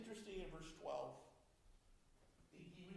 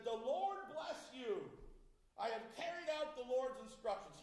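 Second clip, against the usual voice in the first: full of himself; 4 semitones higher, 7 dB louder than usual. Retold or imitated. Saul greets Samuel, boasting he obeyed, smug and excited. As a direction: crow it like a proud boaster. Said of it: "He is full of himself. He is so excited".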